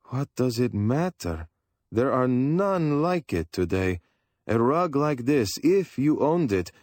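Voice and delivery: a hint of an eager dealer musing on price; voiced Sarkis. A narrator's reading. Old dealer telling the family about the rug, lightly voiced.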